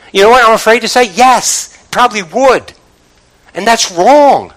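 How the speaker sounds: high, forceful pulpit appeal; impassioned preaching. Real, not acted. Preacher urging the congregation to see God's love and signs, impassioned.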